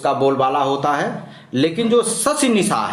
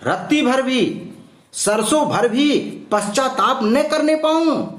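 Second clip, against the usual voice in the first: higher-pitched, insistent queen refusing silence; defiant queen; 6 semitones higher than usual. Acted. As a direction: act out a queen demanding to be heard. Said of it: "अब यहाँ रानी कह रही".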